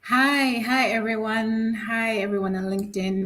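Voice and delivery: normal talking voice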